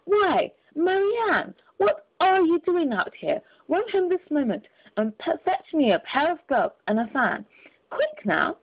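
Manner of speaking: high, slow, sharp scolding rabbit; angry tone. A narrator's reading. Rabbit scolding his housemaid, high and angry. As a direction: scold her like a master snapping at a maid. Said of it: "called out to her in an angry tone".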